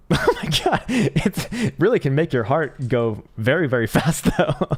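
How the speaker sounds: high, loud, laughing through the words. Real, not acted. Streamer watching a wild one second chart, laughing. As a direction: giggle in disbelief while talking.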